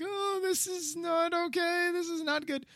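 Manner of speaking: high, soft fussy voice of dismay; mock dismay. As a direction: play a fussy, horrified critic, up high. Mock dismayed reaction quote.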